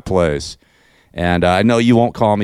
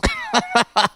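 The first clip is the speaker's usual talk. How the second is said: mocking laugh; 14 semitones higher, 3 dB quieter than usual. Real, not acted.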